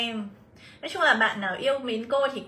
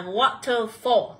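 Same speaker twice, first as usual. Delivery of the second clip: "waterfall" stressed on WA; stress on first syllable; much slower than usual. Shown as a demonstration. Teacher reading option B, "waterfall" stressed on its first syllable. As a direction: lean on the opening syllable of "waterfall". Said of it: "cần nhận vào âm số một".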